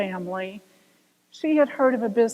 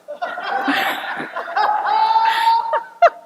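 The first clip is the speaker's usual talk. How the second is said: loud high burst of laughter; big laugh; 10 semitones higher, 3 dB louder than usual. Real, not acted. Teller laughing at own joke, loud laugh. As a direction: crack up at the punchline.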